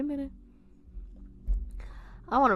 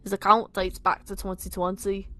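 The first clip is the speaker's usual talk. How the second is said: louder put-on Scouse; bad Liverpudlian accent; 5 dB louder than usual. Nick refusing his friends, bad Liverpudlian accent. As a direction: insist in a rough Liverpool accent. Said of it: "my shit Liverpudlian accent".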